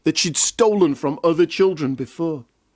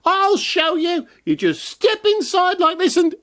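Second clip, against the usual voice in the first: witch voice; 13 semitones higher than usual. Acted. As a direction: show off like a wicked witch. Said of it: "said the witch".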